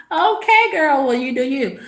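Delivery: high, sassy mock encouragement; mock approval. Speaker mockingly addressing the girl about her chin hair, mock approval. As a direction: pitch it up high, pretending to approve.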